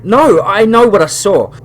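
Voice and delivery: loud, high and slow insistence; frightened boy refusing to be calmed; desperate insisting. Acted. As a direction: plead that you really saw it. Boy insists on what he saw.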